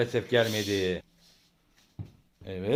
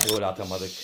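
ordinary delivery

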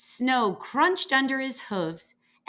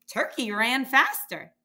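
plain reading voice

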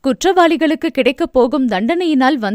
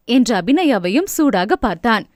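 ordinary delivery

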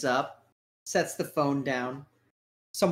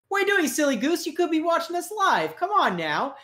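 much higher teasing voice; playful tease; 14 semitones higher, 4 dB louder than usual. Real, not acted. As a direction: scold the viewer like a silly goose, playfully. Host teasing YouTube viewers.

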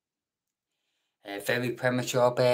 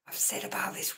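whisper; 7 dB quieter than usual. Real, not acted. Streamer whispers an aside to chat.

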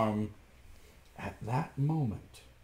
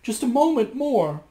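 much higher, louder plea; pleading wife; 12 semitones higher, 8 dB louder than usual. A narrator's reading. Wife begging Bluebeard to wait. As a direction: beg for one more moment. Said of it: "his wife answered".

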